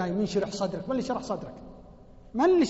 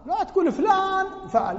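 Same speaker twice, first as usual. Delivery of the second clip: usual speaking voice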